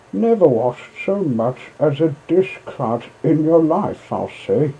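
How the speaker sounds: scornful barge woman sneering at Toad; mocking barge woman. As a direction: taunt him as a fraud.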